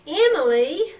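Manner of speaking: loud exasperated call. Acted. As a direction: scold her name, fed up. Exasperated sister.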